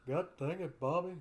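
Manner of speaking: a light take on an exasperated Texan dad; Hank Hill impression. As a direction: grumble it like a fed-up dad. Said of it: "sounds just like him".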